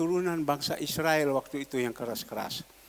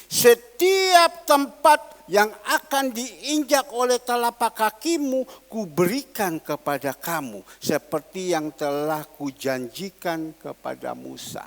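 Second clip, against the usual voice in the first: louder, slow, preached with force; scripture proclaimed; 8 dB louder, slower than usual. Acted. Preacher proclaims God's promise to Joshua, forceful and slow. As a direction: proclaim the verse slowly and loudly. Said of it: "kita melihat".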